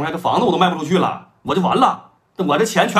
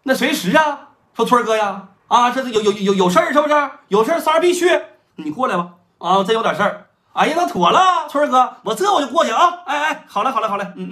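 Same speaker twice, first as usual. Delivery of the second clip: higher, keen underling voice, ready to jump; eager Third Brother; 9 semitones higher, 5 dB louder than usual. Retold or imitated. Third Brother eagerly agreeing to his elder brother's call, high and keen. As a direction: sound only too glad to help.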